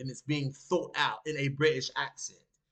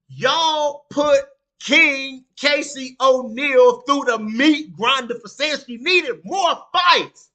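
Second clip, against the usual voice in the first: louder, higher, slow and hammering; angry shout; 7 semitones higher, 9 dB louder, slower than usual. Real, not acted. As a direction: pound each word in anger. Angry rant at fans.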